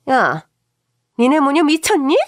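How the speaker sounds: loud, high yell at her daughter-in-law; booming shout. Acted. Mother-in-law shouting at her daughter-in-law on the phone, loud. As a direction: bellow the accusation down the phone. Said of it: "우렁찬 목소리로 고함을 치시는 겁니다".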